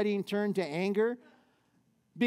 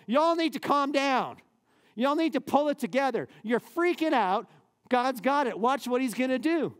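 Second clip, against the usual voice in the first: exasperated Moses; 6 semitones higher than usual. Acted. Moses telling the frightened people to calm down, mildly exasperated. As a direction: tell the crowd to settle down like a fed-up leader.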